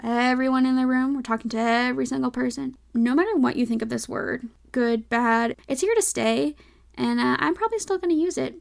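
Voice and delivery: normal talking voice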